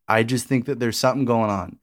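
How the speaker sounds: ordinary talk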